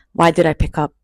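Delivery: ordinary delivery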